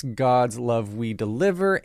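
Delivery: ordinary talk, nothing marked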